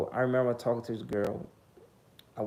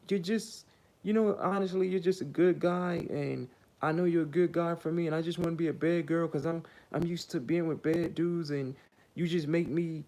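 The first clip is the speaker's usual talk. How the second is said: high, sing-song imitation of her; mock girly woman; 6 semitones higher than usual. Mocking the woman's confession, high and sing-song.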